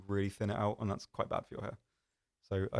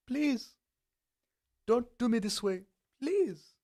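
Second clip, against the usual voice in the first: very high, squeaky mock begging; hammed-up high-pitched plea; mock pleading; 13 semitones higher, 3 dB louder, slower than usual. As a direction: plead in a comically high little voice. Mock pleading to absent joke requester.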